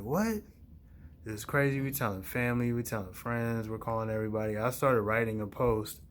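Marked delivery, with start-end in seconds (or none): 0.0-0.4 high surprised what. Retold or imitated. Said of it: "Everybody's like".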